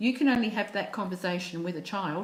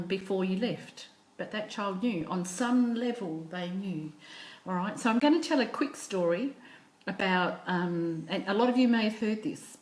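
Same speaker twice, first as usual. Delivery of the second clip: plain reading voice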